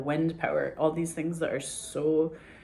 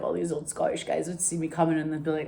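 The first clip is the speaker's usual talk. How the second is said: ordinary delivery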